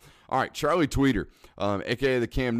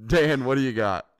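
ordinary talk, nothing marked

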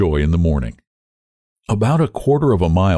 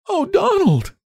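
overjoyed. A narrator's reading. Recognizes long-lost brother.

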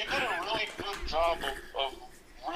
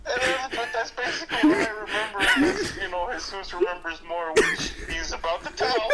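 laughing; 11 semitones higher, 4 dB louder than usual. Real, not acted. Finishing story while laughing.

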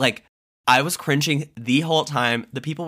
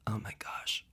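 breathless whispered aside; whispering; 3 semitones lower, 15 dB quieter than usual. Retold or imitated. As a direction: whisper it under your breath. Retold reaction, whispered.

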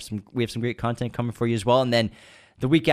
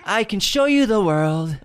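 high, slow nasal Aladdin; sing-talk it like Aladdin, slowly; 10 semitones higher, 4 dB louder, slower than usual. Pretend. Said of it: "compared to Aladdin because of the nasal".

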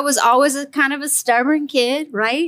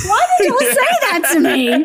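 laughing; 11 semitones higher, 3 dB louder than usual. Real, not acted. Laughing at her own answer.

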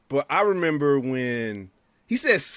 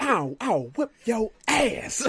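New York; 4 semitones higher, 6 dB quieter, slower than usual. Retold or imitated.

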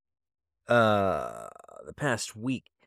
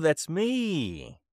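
higher, hammy ghost moan; 5 semitones higher than usual. Pretend. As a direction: wail it like a silly ghost. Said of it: "That was my ghost impression".

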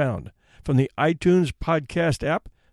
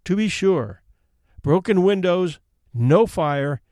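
ordinary delivery, nothing marked

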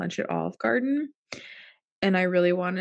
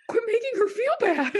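mom voice. Retold or imitated. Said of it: "Mom was like".

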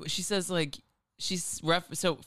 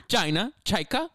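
higher, rising mock Australian names; bad Australian accent; 7 semitones higher, 4 dB louder than usual. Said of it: "My Australian accent is terrible".

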